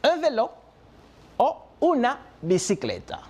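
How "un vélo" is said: "Un vélo" with the article un stressed and raised. Shown as a demonstration. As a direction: lean on "Un" before "vélo". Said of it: "la otra forma que lo pueden decir".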